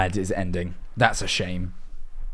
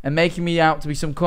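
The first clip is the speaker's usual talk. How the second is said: normal talking voice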